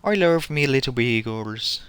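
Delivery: slow, lilting Irish brogue as a leprechaun; hammed-up Irish leprechaun; playful stage Irish accent, drawn out. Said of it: "It is an Irish accent".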